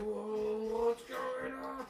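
high, softened, very drawn-out wonder; slow, high, mock-dazed voice; bewildered. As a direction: stretch it out as a startled character. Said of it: "he gets a little sort of like".

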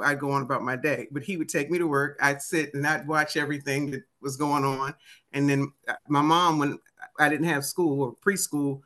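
normal talking voice

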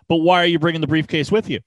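ordinary delivery

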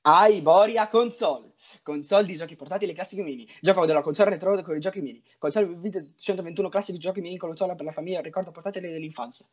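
rattled off like small print; racing through the product blurb; fast ad read. Shown as a demonstration. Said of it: "dobbiamo farla per bene".

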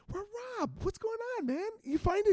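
high-pitched retold questioning; acted excited hello to a friend; eager greeting.

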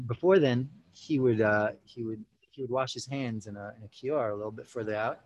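ordinary delivery

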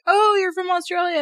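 high, eager mock exclamation; chirpy guessing. Mistaking a stranger's accent for Australian, chirpy guessing. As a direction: pitch it way up, loud and keen. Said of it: "And they're like".

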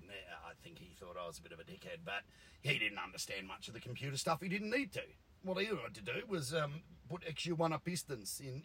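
normal talking voice

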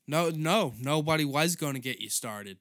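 fed-up comic outburst; exasperated rant. Real, not acted. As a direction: shout it back at her in disbelief. Ranting back at the lady.

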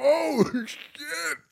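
high startled gasp of surprise; stunned onlooker caught off guard; shocked exclamation. Retold or imitated. Stripper reacting as a drink flies, high and shocked. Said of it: "I was like".

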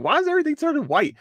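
high-pitched, fast disbelief; rapid high yelp of confusion; baffled reaction. Retold or imitated. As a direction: blurt it out high, caught off guard. Retold reaction, caught off guard.

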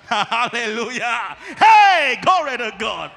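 loud, laughing hallelujah burst; laughing shout of joy. Real, not acted. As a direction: break into a joyful laughing shout. Preacher bursts out laughing joyfully.

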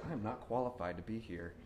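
hushed, low aside to oneself; quiet self-doubt. Acted. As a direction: drop right down as if privately realizing it. Acted sudden self-doubt at work.

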